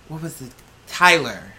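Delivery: ordinary talk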